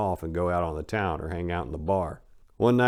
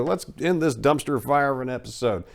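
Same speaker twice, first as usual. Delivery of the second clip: normal talking voice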